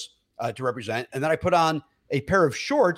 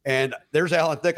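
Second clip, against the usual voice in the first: ordinary talk, nothing marked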